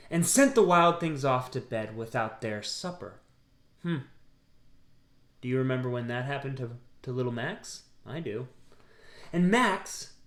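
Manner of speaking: plain reading voice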